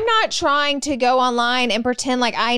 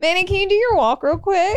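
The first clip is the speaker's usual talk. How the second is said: teasing shout; 7 semitones higher than usual. Real, not acted. Teasing call out.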